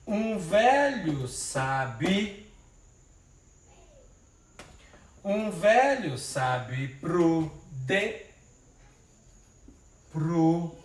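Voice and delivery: very drawn-out, beat by beat; slow syllable scansion. Shown as a demonstration. Teacher slowing the verse to show the syllable merge. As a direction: pull each syllable apart slowly. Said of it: "A contagem vai até a última sílaba tônica".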